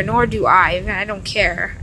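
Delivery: usual speaking voice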